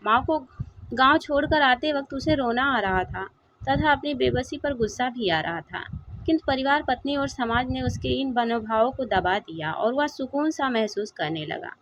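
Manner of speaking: ordinary delivery, nothing marked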